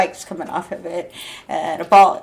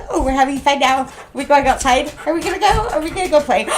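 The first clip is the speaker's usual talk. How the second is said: high, eager voicing of the dog, climbing higher on "go"; excited dog voice; 7 semitones higher, 4 dB louder, much faster than usual. Voicing her excited pit bull asking to play, high and eager. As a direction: play the dog begging to go outside. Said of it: "'cause she's like".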